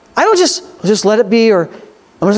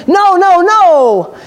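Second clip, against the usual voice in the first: higher, louder stubborn no, no, no; flesh refusing; 7 semitones higher, 3 dB louder, much slower than usual. Acted. Preacher voicing the flesh, a loud stubborn refusal. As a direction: dig in like a balky child.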